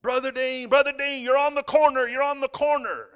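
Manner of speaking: imitating a frantic passenger, very high and loud; high-pitched retold shriek of alarm; panicked screaming. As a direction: scream a warning at the driver. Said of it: "Don starts screaming".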